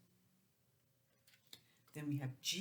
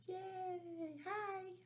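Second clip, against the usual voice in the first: much higher happy squeal; cheering; 13 semitones higher, 4 dB quieter than usual. Real, not acted. Teacher cheering and greeting the kids. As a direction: cheer with delight.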